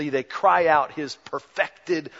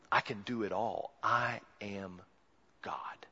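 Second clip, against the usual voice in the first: hushed voice of Jesus; 5 semitones lower, 10 dB quieter than usual. Acted. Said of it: "just to say right straight up".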